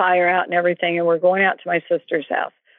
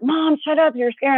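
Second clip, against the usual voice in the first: lightly voiced kid cutting Mom off, higher; mimicked child's protest; 6 semitones higher than usual. Kids telling their mother to stop scaring them, mimicked protest. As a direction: imitate a rattled child's mild objection, pitched up.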